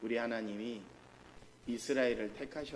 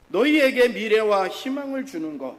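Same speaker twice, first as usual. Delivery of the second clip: 크고 높게 외치는 말씀; 온 힘으로 외쳐 선언하듯; 7 semitones higher, 15 dB louder than usual. Acted. (회중에게 크고 높게 말씀을 선포)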